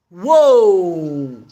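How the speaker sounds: loud low startled whoa; shocked whoa. Retold or imitated. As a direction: gasp it out in shock. Exclamation of shock.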